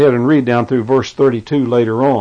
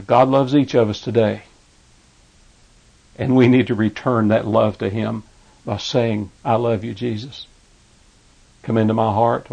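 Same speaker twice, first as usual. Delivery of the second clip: softer, lower appeal, gently pleading; tender invitation; 3 semitones lower, 4 dB quieter than usual. Acted. Preacher inviting listeners to receive Jesus, soft lower tender appeal. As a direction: ease down and invite them to pray. Altar call, model prayer.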